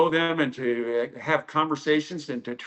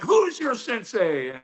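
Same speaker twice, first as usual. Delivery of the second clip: scary sensei's drawn-out, higher-pitched question; retold stern demand; 7 semitones higher, slower than usual. Scary sensei questioning the late student, stern demand. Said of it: "He was a scary man when he's screaming".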